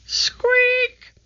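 tiny, very high-pitched squeak; high mousy squeal; mouse squeak. A narrator's reading.

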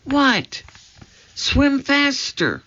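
high, slow and urgent, scared; high, slow, frightened urging; tense high urgency. A narrator's reading. Scared in dark moat.

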